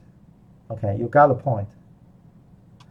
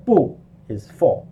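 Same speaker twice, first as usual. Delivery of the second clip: normal talking voice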